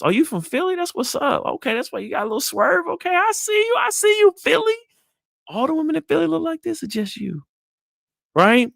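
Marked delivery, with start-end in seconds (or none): 0.0-7.4 much higher, lively, teasing flirt; showing interest. Shown as a demonstration. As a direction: pile on high, playful enthusiasm. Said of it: "I'm showing interest".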